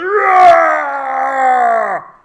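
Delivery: loud drawn-out bear roar, hammed-up; mock bear roar. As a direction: roar like a grizzly standing up. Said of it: "He stands up and he goes".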